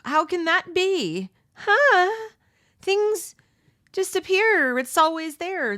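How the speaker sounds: high, loud, whiny mocking voice; mock clueless shopper. Mocking people who can't find toilet paper, whiny and high. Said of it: "There were people who would just".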